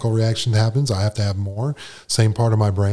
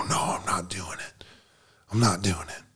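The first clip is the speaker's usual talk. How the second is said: hushed, firm whisper of no; whispered refusal; 3 semitones lower, 9 dB quieter than usual. Retold or imitated. Recovering alcoholic refusing his inner urge, whispered. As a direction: whisper it like you're resisting a craving.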